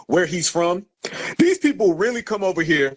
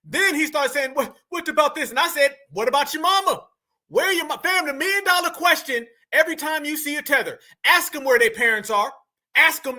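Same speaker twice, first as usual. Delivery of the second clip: high, fast excited rant; 7 semitones higher than usual. Real, not acted. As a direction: rant at the audience, wound up. Excited high ranting to audience.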